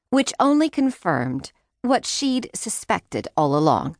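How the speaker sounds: ordinary delivery, nothing marked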